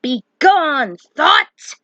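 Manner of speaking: high, loud dramatic command; quoted shout. Retold or imitated. Vlogger quoting a video line, shouting it high. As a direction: banish the thought with a cry. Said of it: "To quote something I've heard".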